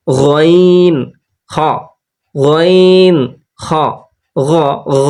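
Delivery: gargle the গইন from the back of the throat; gargled ghain. Shown as a demonstration. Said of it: "হালক বা কণ্ঠনালী শেষ হতে উচ্চারিত হয়". (গড়গড়ার মতো গইন)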